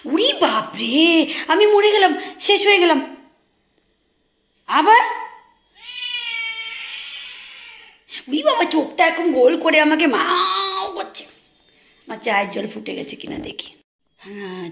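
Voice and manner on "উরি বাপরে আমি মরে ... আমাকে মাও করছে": high, loud hammed-up gush, laughing; mock overwhelmed. Real, not acted. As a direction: play dying of cuteness. Mock dying over pet's cuteness.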